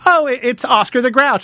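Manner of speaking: high, mock-excited adult gushing over a puppet. Adult at a puppet show, high mock gushing. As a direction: gush like a clueless grown-up. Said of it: "they'll go".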